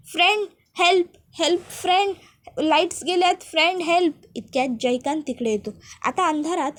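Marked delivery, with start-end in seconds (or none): 0.1-4.1 a touch higher, half-acted cry for help; mock call for a friend in the dark. Pooja calls for her friend when the lights go out, mild mock cry. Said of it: "ओरडू लागते".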